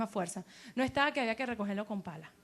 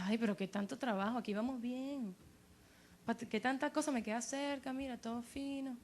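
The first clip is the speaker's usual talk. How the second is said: mock someone who can't be bothered, much quieter; reluctant complainer; 9 dB quieter than usual. Imita al que no quiere arriesgar. (quejoso con fastidio, en voz baja)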